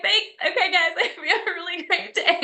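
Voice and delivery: imitating her own high, squeaky cheery goodbye. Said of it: "My, my voice probably, like, raised a couple notches".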